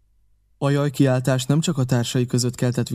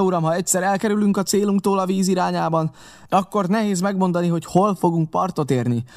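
raised pitch, pressing explanation; insistent captain; 4 semitones higher than usual. Acted. Captain explaining the course against the current, insistent and higher. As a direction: argue the case higher and intently.

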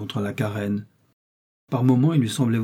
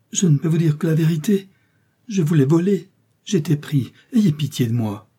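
higher, louder mock-terrified pleading; feigned pleading; 6 semitones higher, 5 dB louder than usual. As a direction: beg for mercy, faking terror. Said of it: "gémit-il".

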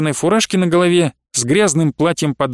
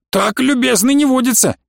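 much higher, indignant outburst; angry porter; 7 semitones higher than usual. A narrator's reading. Night porter berates Vedeneev in the corridor, indignant and much higher. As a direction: catch the thief red-handed.

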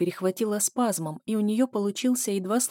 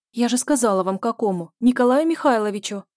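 louder, higher exasperated insistence; insistent shout; 4 semitones higher, 6 dB louder than usual. A narrator's reading. Zhenya loudly repeats the relative's name to the strangler. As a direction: hammer it home.

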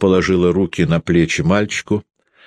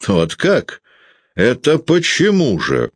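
higher, a mild spiteful edge; 8 semitones higher, slower than usual. A narrator's reading. Peredonov spitefully questioning Sasha, a touch of malice. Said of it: "со злобою спросил Передонов".

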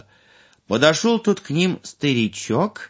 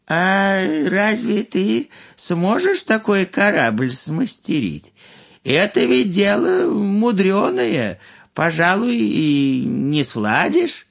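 higher, slow doubting elder; doubtful old man; 8 semitones higher than usual. Acted. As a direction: question him gently.

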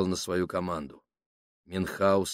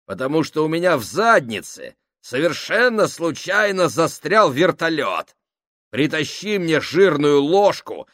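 loud, higher sarcastic rant; mocking tirade; 7 semitones higher, 8 dB louder than usual. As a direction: jeer loudly to get laughs. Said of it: "Его тирада вызвала несколько смешков".

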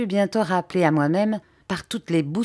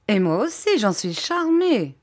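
delighted; 5 semitones higher than usual. A narrator's reading. Steerforth answers his old friend's greeting, delighted. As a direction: return the greeting with hearty pleasure.

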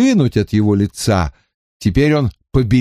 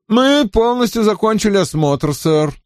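высокий голос лейтенанта; важный доклад подчинённого; Роуклифф; 11 semitones higher than usual. A narrator's reading. (голос Роуклиффа)

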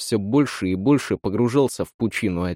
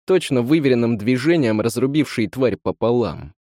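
ordinary delivery

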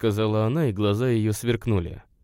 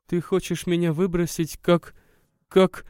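сквозь слёзы, всё громче; 8 semitones higher than usual. A narrator's reading. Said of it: "опять покатились слёзы". (женщина в слезах, к концу громче)

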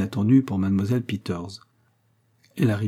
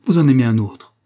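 loud, raised accusation; sudden outcry; 4 semitones higher, 9 dB louder than usual. A narrator's reading. Frédéric suddenly accusing Agnès of loving another, crying out. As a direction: burst out, accusing her. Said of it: "s'écria tout à coup Frédéric".

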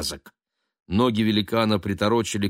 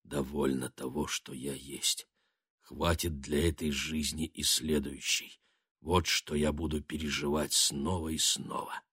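low, soft echo in the mind; hushed inner echo of words; distant flashback echo; 4 semitones lower, 9 dB quieter than usual. A narrator's reading. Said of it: "слова его эхом звучали в голове".

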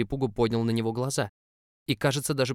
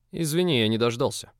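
mocking; 2 semitones higher, 5 dB louder than usual. A narrator's reading. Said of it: "лёгкая интонация издёвки".

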